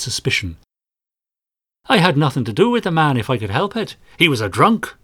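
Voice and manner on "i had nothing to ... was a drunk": Irish trader. Acted. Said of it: "a middle-aged man of Irish stock".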